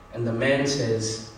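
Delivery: normal talking voice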